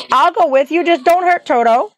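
high-pitched, distressed plea; crying out. A narrator's reading. Dorothy begs the witch for Toto, crying out. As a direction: plead for the little dog. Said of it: "cried Dorothy".

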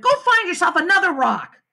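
high, annoyed order; exasperated shout. A narrator's reading. Peter orders Sheila off his rocks, annoyed shout. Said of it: "I shouted".

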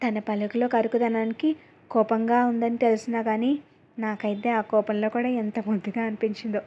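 usual speaking voice

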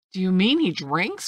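exclaimed question. A narrator's reading. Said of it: "cried the squire".

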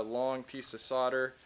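ordinary delivery

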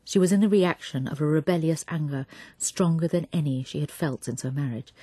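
usual speaking voice

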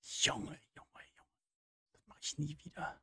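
breathless whispered relief; whisper, shaken after a fright. Real, not acted.

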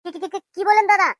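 repeated first word, tripping over it; excited stammer. Acted. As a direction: stutter in eager disbelief. Stammering at a promised feast.

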